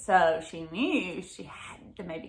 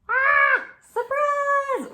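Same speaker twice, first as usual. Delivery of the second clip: big high gasp of surprise; excited surprise; 6 dB louder, much slower than usual. Acted. Teacher reading to kids, acts out a big surprised gasp. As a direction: show the kids how surprised she looks.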